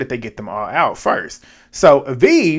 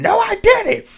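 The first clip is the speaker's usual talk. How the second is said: high yelled denial; mock shouted no; retold scream; slower than usual. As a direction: shriek the denial back. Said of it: "C T is screaming".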